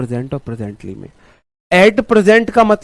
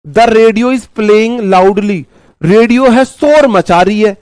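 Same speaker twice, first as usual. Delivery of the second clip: high, raised teacher's voice; loud example; 5 semitones higher, 5 dB louder, much slower than usual. Shown as a demonstration. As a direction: slowly belt out the example sentence. Demonstrating loudly with radio example. (ऊँची, ज़ोरदार आवाज़ में)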